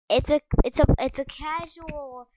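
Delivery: loud, slow, stunned outrage; outraged shout. Real, not acted. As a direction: yell the price in disbelief. Outraged at item price.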